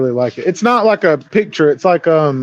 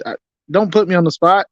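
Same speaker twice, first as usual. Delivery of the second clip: ordinary delivery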